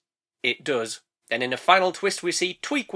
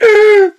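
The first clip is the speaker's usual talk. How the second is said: sharp loud high gasp; overacted shocked gasp; dramatic gasp; 14 semitones higher, 10 dB louder than usual. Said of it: "Maybe a little overdramatic there".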